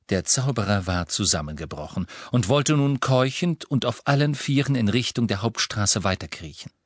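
ordinary delivery

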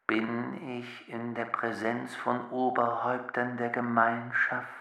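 soft, even computer voice; the box addressing the elders, soft; talking machine. Acted. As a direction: speak like a polite machine. The box speaks.

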